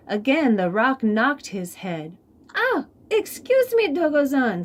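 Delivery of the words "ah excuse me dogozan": high, light polite interruption; chameleon's polite voice. Acted. Chameleon politely stepping in, high and light. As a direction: butt in politely in a small high voice. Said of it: "said the chameleon".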